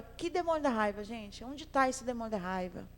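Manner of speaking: ordinary delivery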